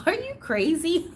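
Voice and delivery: high-pitched incredulous quote; retold disbelief. Collector retelling her reply to a gifter, high mock disbelief. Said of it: "And I was like".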